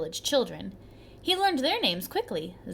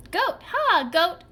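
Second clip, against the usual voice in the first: higher, louder call to the goat; 7 semitones higher, 5 dB louder, slower than usual. A narrator's reading. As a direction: shout across the rocks. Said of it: "boy calling the goat".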